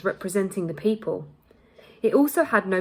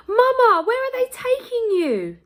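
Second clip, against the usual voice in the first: high, loud, frightened demand; 14 semitones higher, 4 dB louder than usual. A narrator's reading. Boy demanding to know where his mother is taken. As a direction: insist on an answer, scared. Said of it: "demanded Alfred".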